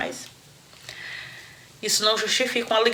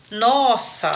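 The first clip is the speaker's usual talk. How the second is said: normal talking voice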